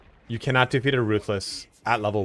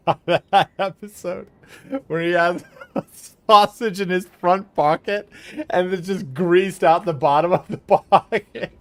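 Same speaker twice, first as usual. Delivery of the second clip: laughing; 9 semitones higher, 6 dB louder than usual. Real, not acted. Laughing while describing sausage episode.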